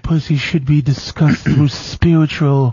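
a prissy parody of Jeff; mocking Jeff. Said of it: "Jeff wouldn't do it".

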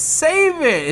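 high, loud shouted save it; hammed-up Goku scream; anime scream. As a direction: yell it like an anime hero.

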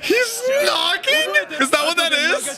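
high, stretched shocked shout; shocked yell. Real, not acted. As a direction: blurt it out stunned. Shocked by a scene.

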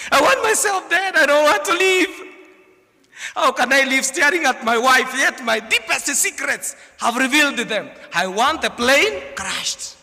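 high, loud wail of despair; a shamed husband shouting at the plane; loud despairing cry. Acted. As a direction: cry out that you'd rather die. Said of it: "spoke loudly".

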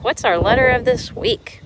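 ordinary delivery

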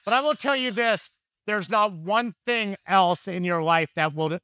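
preaching emphasis. Real, not acted. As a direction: drive the point home from the pulpit.

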